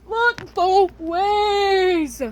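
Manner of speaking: slow singsong echo of the warning; drawn-out advice. Acted. Stretched advice to herself.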